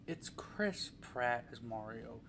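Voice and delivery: deadpan resigned. Real, not acted. Repeats casting, deadpan resigned.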